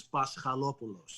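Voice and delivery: Paschalopoulos with a Greek accent, stressed on LO. Shown as a demonstration. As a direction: say Paschalopoulos the Greek way, pas-kha-LO-pu-los. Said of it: "say it in Greek with the Greek accent".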